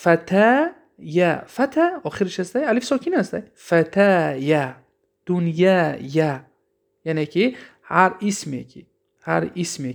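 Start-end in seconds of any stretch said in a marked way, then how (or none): 0.0-1.4 فتا یا said very slowly, with the یا set apart; slow demo pronunciation. Shown as a demonstration. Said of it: "با مثال دیگر است که". 3.7-6.4 فتا یا said very slowly, with the یا set apart; slow demo pronunciation. Shown as a demonstration. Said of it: "با مثال دیگر است که".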